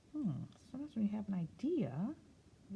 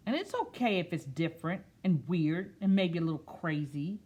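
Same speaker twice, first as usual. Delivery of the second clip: slow storybook read-aloud; picture book voice; reading to children; 9 dB louder than usual. Acted. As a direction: read it gently to a class.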